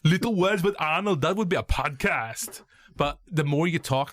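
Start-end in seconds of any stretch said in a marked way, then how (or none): 0.0-2.6 bad Arnie voice slipping towards Kermit; Arnold. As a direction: put on an Arnold Schwarzenegger voice. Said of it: "more I sound like Kermit the Frog".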